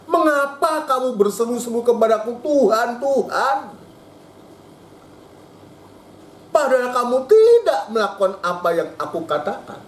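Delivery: God's lament, pitched high and pleading. Acted. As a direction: plead as the Lord asking why. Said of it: "Tuhan mengatakan". (keluhan Tuhan dengan nada tinggi)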